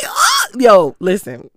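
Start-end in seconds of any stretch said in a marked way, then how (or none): 0.1-0.2 much higher shouted oh; shocked gasp. Retold or imitated. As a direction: blurt a stunned oh. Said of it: "I was like".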